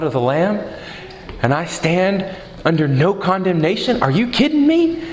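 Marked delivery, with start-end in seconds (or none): 4.0-5.0 excited disbelief. Retold or imitated. Eager believer exclaims.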